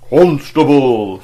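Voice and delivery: huge, slow, effortless shout; booming call. Acted. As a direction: project across the courtyard to the policeman. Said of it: "with no more effort than if he had been whispering".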